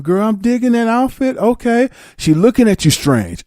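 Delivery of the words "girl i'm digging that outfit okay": high, bubbly compliment; mock impressed newcomer. Newcomer complimenting the mistress, high and bubbly. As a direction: gush about her outfit. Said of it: "You going".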